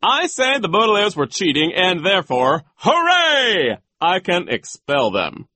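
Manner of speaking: high, loud, gloating decree; pompous, gleeful tyrant; triumphant vice principal. Acted. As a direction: crow over your power to expel. Voices Nero triumphant.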